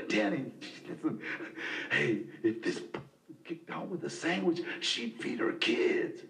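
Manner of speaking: put-on low, hushed voice of an older man setting him straight; older sponsor. Sponsor pulling Danny aside, low and confiding.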